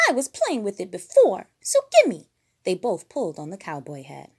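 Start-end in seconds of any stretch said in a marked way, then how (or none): 0.1-2.3 high, loud demanding voice; rising to a shrill high gimme; Piggy. A narrator's reading. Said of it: "Piggy's quarrel".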